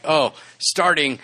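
ordinary delivery

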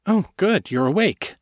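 bright lift on Oh, suddenly hopeful; relieved the woman woke, he perks up; perking up. A narrator's reading. Perking up, hopeful. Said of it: "perking up".

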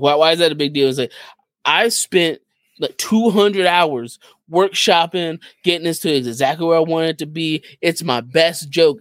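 ordinary talk, nothing marked

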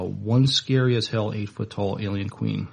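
normal talking voice

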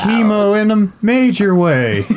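slow, high, chanted rhyme punchline; mock poem recital. Game master finishes the joke poem, mock recital. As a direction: land the silly rhyme with drawn-out bounce.

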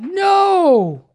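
sudden high shouted No; crowd yelling No. Retold or imitated. Preacher voicing the crowd's answer to the pope, shouted. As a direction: shout it back like the crowd in the square. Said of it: "yelled out".

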